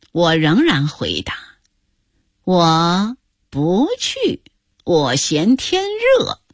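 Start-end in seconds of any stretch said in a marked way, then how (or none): 2.4-6.4 very drawn-out, swooping words; stubborn refusal. Acted. Grandma refuses the outing, stubborn drawl. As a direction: dig in with playful obstinacy.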